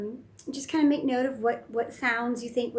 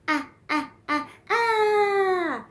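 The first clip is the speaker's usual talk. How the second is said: rising, louder and higher ahs; playful ahs with motions; 11 semitones higher, 9 dB louder, much slower than usual. Shown as a demonstration. Parent playing with toddler, ahs getting higher. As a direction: climb up with each ah. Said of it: "hand motions".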